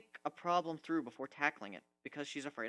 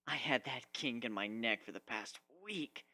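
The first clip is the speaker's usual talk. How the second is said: plain reading voice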